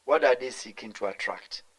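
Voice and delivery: usual speaking voice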